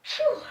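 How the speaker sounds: a sudden real sneeze; sneezing. Real, not acted. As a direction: sneeze out loud, then exhale. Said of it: "I'm gonna sneeze".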